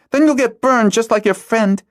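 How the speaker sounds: put-on a frightened girl warns her friend angrily; fearful anger. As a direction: throw it at him, scared. Hala fights his nodding.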